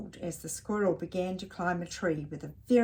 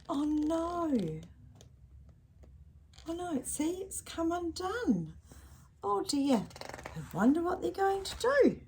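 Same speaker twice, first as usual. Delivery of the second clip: worried; 10 semitones higher, 4 dB quieter than usual. Real, not acted. Reader reacts to fall.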